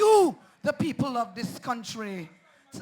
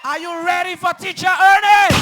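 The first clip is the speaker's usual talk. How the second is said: higher, much louder shout to the crowd; escalated roaring call; big rally shout; 8 semitones higher, 16 dB louder than usual. Real, not acted. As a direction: blast the question to the whole crowd. Repeats the question, much louder.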